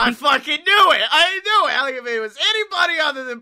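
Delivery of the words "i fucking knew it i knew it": high, loud vindicated yell; loud I-knew-it yell; triumphant shout. Real, not acted. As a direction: shout it like you called it.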